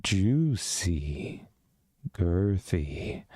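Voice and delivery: lightly hammed-up seductive voice; mock sultry low voice. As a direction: drop low and drawl each word slowly. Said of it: "You want me to say juicy?".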